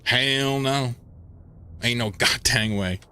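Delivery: lightly hammed-up meme Southern drawl, y'all and daggum; a hint of a cranky Southern trucker in Southern drawl. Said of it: "I have a halfway decent meme version".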